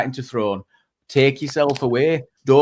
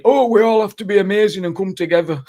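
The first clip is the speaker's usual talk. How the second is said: higher, mock rallying cry; mocking quote; 6 semitones higher than usual. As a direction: imitate their togetherness plea, pitched up. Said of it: "they're just basically saying".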